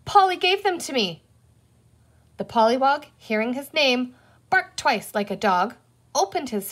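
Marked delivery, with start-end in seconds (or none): 0.0-1.2 high-pitched indignant protest; outraged. A narrator's reading. Boy blames the toddler for the jelly beans, outraged. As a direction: shift the blame hotly, like a small boy.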